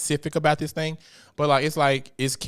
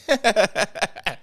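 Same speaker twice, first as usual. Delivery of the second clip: high, loud burst of laughter; real amused cackle; laughing; 8 semitones higher, 4 dB louder than usual. Real, not acted. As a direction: crack yourself up after talking tough. Laughs after her own threat.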